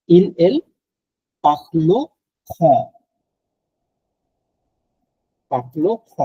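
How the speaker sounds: পাখলো খ with the r pulled back into the throat; guttural r in পাখলো খ demonstrated; throaty French r. Shown as a demonstration. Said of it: "এটা একটু গলার মধ্যে নিয়ে আসতে হবে".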